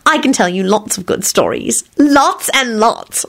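high, stretched delight; jubilant squeal. A narrator's reading. Solange promising Quentin stories, jubilant. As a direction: build to a drawn-out squeal. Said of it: "she squealed jubilantly".

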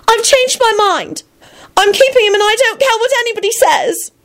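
very high, desperate wailing; distraught wail. A narrator's reading. Elizabeth insisting on keeping her baby, high wail. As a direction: wail it defiantly, far up in pitch. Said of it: "Elizabeth suddenly wailed".

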